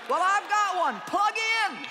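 high, loud punchline; triumphant shout. Real, not acted. Preacher answering the crowd's prayer, triumphant loud shout.

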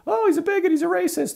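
high, loud squeal of outrage; mocking outraged person. Mimicking an offended person who calls him a bigot, high squeal. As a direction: shriek it like an offended scold. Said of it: "And the other person, like".

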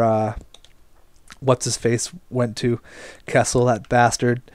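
usual speaking voice

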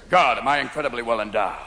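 low, self-satisfied cop voice; motorcycle cop. Acted. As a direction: swagger through it in a deep voice. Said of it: "He gets off his bike like".

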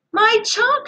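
high, delighted cry; girl thrilled to get her chalk back; glad cry. A narrator's reading. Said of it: "she cried".

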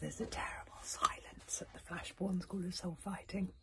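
soft, breathy storyteller hush; whispered spooky story reading; hushed suspense narration. A narrator's reading. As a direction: draw them into the quiet.

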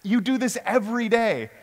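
high-pitched calling over the wind; mock shout of disbelief to the driver; retold shout. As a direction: raise the pitch as if yelling over rushing wind. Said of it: "I shout because the wind".

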